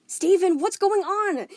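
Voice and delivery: high, loud angry yell. Acted. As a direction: yell at him through the door. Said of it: "Amethyst yelled".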